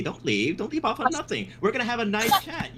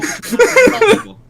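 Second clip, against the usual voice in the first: loud outrage; 13 semitones higher, 13 dB louder, slower than usual. Acted. Mock outrage in argument.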